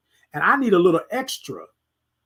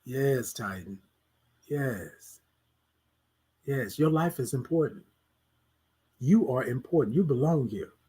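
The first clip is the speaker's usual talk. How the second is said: much softer, lower reassurance to yourself; soft self-reassurance; 6 semitones lower, 8 dB quieter than usual. Shown as a demonstration. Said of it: "talk yourself through it".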